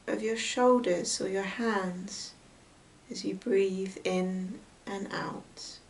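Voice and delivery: hushed, unhurried meditation voice with long pauses; guided meditation. Real, not acted. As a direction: gently guide them into noticing the breath. Leads a breath meditation.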